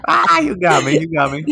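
conceding through a laugh. Real, not acted. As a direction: laugh it out, caught.

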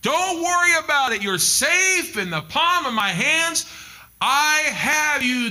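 high, loud, slow reassuring divine voice; calm, booming God promising safety. Acted. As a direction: speak as God comforting his child, slowly. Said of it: "God said".

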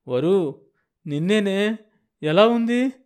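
climbing to a high, loud pleading cry; anxious calling. Acted. Husband calling his wife's name, rising in panic. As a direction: beg her to answer.

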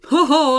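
put-on jolly old wizard chuckle. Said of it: "The wizard chuckled".

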